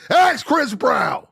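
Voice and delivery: high, slow, loud yell; shrill shouted comeback; screamed retort. Shown as a demonstration. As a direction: scream it in her face. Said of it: "start screaming".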